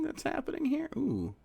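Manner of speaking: softly whispered question. Real, not acted. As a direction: whisper it to yourself. Whispering to himself.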